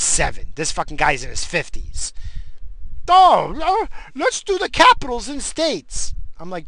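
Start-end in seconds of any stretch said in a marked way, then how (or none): 3.1-6.1 high, loud mock-eager voice; mocking coworker impression. Coworker pitching a capitals game, mocking high voice. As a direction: pipe up like an over-keen, annoying coworker.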